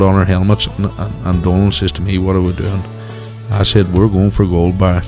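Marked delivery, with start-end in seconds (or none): none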